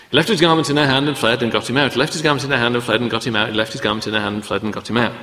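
rattled off fast, three times over; insistent repetition. Shown as a demonstration. Preacher drilling the repeated verse into the congregation, fast insistent. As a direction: hammer the phrase home quickly, again and again. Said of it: "insistent repetition".

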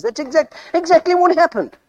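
high, excited exclamation; amazed confirmation. Acted. Moses amazed that Jethro guessed.

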